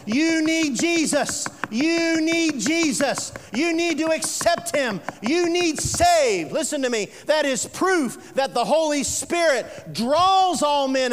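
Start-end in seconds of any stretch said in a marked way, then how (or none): 0.1-6.5 urgent plea. Acted. Preaching urgent appeal.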